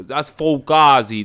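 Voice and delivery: Fugazi drawn out slow and loud in a mock New York Italian accent. Podcaster slowly demos Fugazi in mock New York Italian. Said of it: "a bad New York Italian accent".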